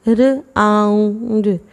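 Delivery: slow र आऊंड, sliding the आऊ; sounding out. Shown as a demonstration. Teacher sounding out round slowly. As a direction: glide आऊ slowly inside र आऊंड. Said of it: "राउंड में ना आऊ".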